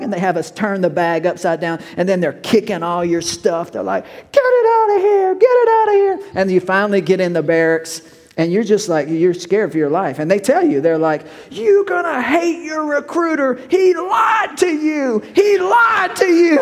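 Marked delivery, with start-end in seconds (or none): 4.3-6.3 much higher and louder, barking drill sergeant orders; drill sergeant shouting. Retold or imitated. Drill sergeant kicking stuff. 11.5-16.6 much higher, slow mocking shout; taunting drill sergeant.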